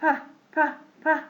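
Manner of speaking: short puffed P for "ب"; P with air. Shown as a demonstration. Teacher demonstrating letter P, short puffs of air on "ب". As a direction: pop "ب" as P, letting air out. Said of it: "في هوا طالع".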